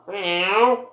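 playful high meow at a cat; a quick meow aimed at the game cat. Pretend. Streamer meows at in-game cat.

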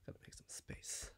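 soft late-night whisper. Real, not acted. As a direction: whisper so nobody wakes.

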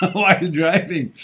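said through a laugh, high and loud. Real, not acted. Telling listeners how he cracks himself up driving alone, laughing. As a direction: let real laughter lift and break into the words. Amused by his own story.

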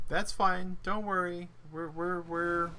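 high, light reassuring tone; mock reassurance. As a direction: brush it off cheerfully. Reassuring self after in-game loss.

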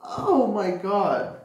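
father reacting to hitting his finger; mock shock. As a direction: play the startled dad for the kids. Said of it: "And he was like".

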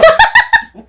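loud, high burst of laughter; high-pitched laugh. Real, not acted. Wife laughs at husband's explanation.

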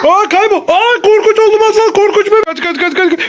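high-pitched, breakneck mock alarm; play panic. Parent tells child to run from scary baby, play panic. Doll suddenly turns scary again.

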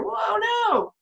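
high whoa, no falling away; surprised whoa. Real, not acted. Teacher exclaiming at the flying car picture, surprised. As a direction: gasp it out in mock alarm.

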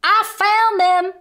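shouted. Acted. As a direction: call across the desert to the sheriff. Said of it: "Cowboy Baby shouted to Sheriff Parr".

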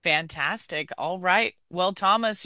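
normal talking voice

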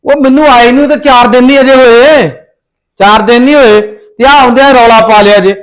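loud demand to his mother; angry shout. Acted. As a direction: yell it, fed up and furious. Family quarrel, raised voices.

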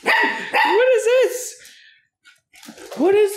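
high, slow pet voice. Real, not acted. Owner cooing at her puppy's antics, high and slow. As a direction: coo the questions at the puppy.

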